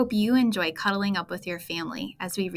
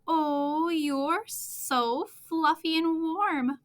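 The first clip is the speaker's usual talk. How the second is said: slow, higher, fond cooing; friendly voice; 8 semitones higher, much slower than usual. Acted. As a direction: stretch it out sweetly to the pet. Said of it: "a friendly voice says".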